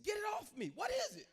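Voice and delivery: high, loud, frantic yell; panicked shout. Retold or imitated. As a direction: shout in terror to get it off. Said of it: "I said".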